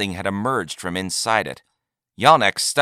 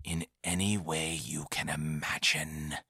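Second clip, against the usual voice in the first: hushed, secretive whisper; conspiratorial whisper; 3 semitones lower, 13 dB quieter than usual. A narrator's reading. Trader leaning in to the buyer, conspiratorial whisper. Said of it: "whispered in a conspiratorial manner".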